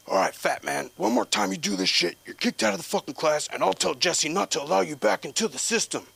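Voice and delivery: high, loud mock coach threat; threatening coach. Coach threatening to kick him out of class, high and loud. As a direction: warn him off like a fed-up trainer.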